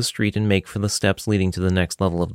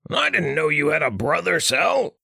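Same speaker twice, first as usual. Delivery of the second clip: much higher put-on voice; Richter character voice; 8 semitones higher than usual. Richter greets Saladia's brother in a high put-on voice. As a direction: play the odd companion, pitched up.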